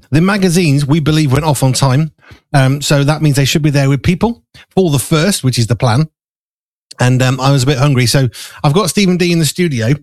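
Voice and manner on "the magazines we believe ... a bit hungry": ordinary talk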